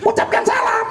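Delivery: high puppet voice repeating the order; puppet parroting. Acted. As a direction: copy the teacher as a silly puppet. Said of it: "kok ucapkan salam". (suara boneka tinggi meniru)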